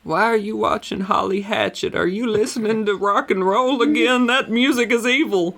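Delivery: high mock scold about rock and roll; mock disapproving parent. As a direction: lecture like a shocked church mom.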